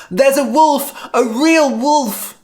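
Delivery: high, loud urgent cry. A narrator's reading. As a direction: shout the news through town. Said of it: "George ran into town and cried".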